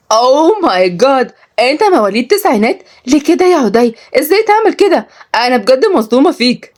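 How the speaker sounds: high, exaggerated female disbelief; mock shock. Young woman teases him for being born in nineties, mock-shocked. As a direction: stretch the exclamation and pitch it high.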